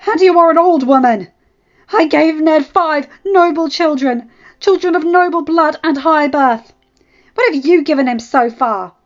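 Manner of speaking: loud, high, demanding; wife daring her rival before the gods; furious challenge. Acted. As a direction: dare her to deny it.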